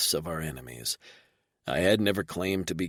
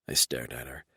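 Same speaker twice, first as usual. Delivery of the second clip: ordinary delivery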